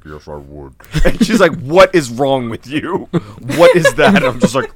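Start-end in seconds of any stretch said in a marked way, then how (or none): 0.1-0.7 very low, quiet sick voice; under-the-weather voice. Retold or imitated. Answering buddy's wife about breakfast, low sick voice. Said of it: "my voice was just like". 1.6-4.1 loud, imitated appalled questioning; aghast. Retold alarmed wife to sick speaker.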